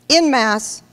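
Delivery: usual speaking voice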